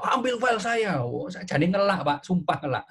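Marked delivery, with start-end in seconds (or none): 0.0-1.0 high, raised teacher's shout; demo shout. Retold or imitated. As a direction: show how you shouted at the class. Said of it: "saya tadi sampai mencontohkan berteriak".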